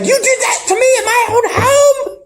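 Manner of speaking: high, squealing mock outrage; mock outraged wife. Telling the show's story, high squealing mock outrage. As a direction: squeal it like a wronged wife, half laughing.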